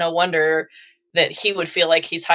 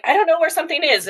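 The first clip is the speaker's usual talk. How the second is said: put-on higher, fast whiny kid voice; helpless kid; 10 semitones higher, faster than usual. Parent mimicking helpless kids, higher and whiny. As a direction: lightly play a whiny child who can't find things.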